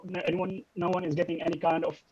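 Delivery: ordinary talk, nothing marked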